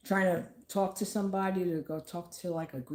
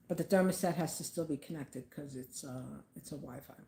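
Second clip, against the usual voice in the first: usual speaking voice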